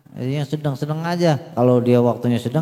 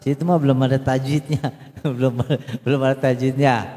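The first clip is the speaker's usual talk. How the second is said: ordinary talk, nothing marked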